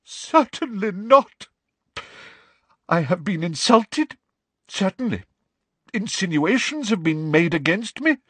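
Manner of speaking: proud and hurt, protesting too much; throbbing with emotion. A narrator's reading. Trelawney denying anything is wrong, high and throbbing. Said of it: "in a voice throbbing with emotion".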